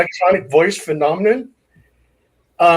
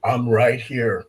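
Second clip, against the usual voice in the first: low and slow, like a spirit on a recording; ghostly EVP voice; 6 semitones lower, 4 dB quieter, slower than usual. Retold or imitated. Ghost answering the investigator on playback, low and slow. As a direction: drag it out low, an eerie voice from the tape. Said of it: "you'll have something saying".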